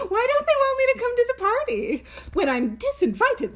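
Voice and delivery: high-pitched mock wail about being left out; hammed-up self-pity through laughter; mock lament. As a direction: playfully wail it, pitch jumping right up.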